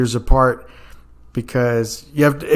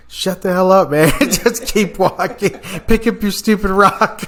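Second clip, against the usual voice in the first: higher, laughing telling off; laughing mock yell; 7 semitones higher than usual. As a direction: tease him while laughing. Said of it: "everybody's just telling him".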